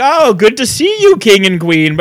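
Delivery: mock party greeting. Imitates party guest greeting royal children.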